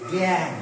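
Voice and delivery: জ্ঞান said as a nasal g sound; nasalised g. Shown as a demonstration. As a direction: say জ্ঞান with the g sent through the nose. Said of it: "মানে গটা নাকে উচ্চারণ করছি". (জ্ঞান-এর গ নাকি সুরে)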